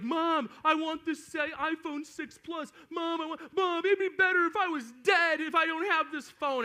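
high, drawn-out mock tantrum, building loud; kid freaking out. Seven-year-old begging mom for a phone, hammed-up freak-out. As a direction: imitate a child melting down at mom. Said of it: "this seven-year-old kid's freaking out".

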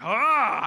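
loud, high mock scream of fright; startled shepherd boy, loud mock scream. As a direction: shriek like a spooked kid. Shepherds see the angel.

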